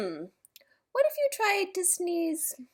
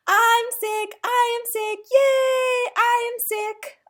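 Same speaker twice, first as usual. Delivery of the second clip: higher, louder joyful cheer; delighted; 10 semitones higher, 8 dB louder, slower than usual. Acted. Elephant glad it's only a cold, joyful cheer. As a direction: celebrate being sick. Happy to be sick.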